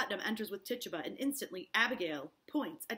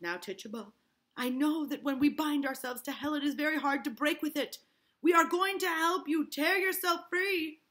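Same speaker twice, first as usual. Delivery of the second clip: gentle coaxing; 7 semitones higher, 7 dB louder than usual. Acted. Hale gently coaxing Tituba to confess. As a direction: coax her gently.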